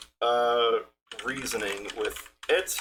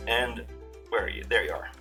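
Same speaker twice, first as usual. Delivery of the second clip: ordinary talk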